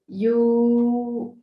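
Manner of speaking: ordinary talk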